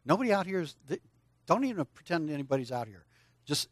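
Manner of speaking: usual speaking voice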